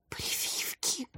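very soft, drawn-out terrified whisper; hushed voice full of dread; horrified whisper. A narrator's reading. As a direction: whisper it in horror. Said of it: "с ужасом прошептала Варя".

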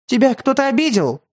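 high, frightened grandmother pleading; frightened, near tears. A narrator's reading. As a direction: ask in panic, almost crying. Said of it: "бабушка сама едва не плакала".

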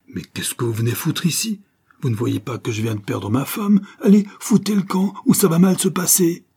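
indignation building to a loud, high shout; angry outburst. A narrator's reading. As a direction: blow your top and order them out. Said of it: "je sens que Bart va péter un boulon". (colère qui monte jusqu'au cri)

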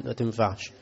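ordinary talk, nothing marked

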